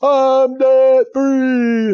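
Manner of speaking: high, loud, long-drawn mock victory cry; callers' triumphant yell. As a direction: stretch every word out in a hollered imitation. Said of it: "they all get on the line and say".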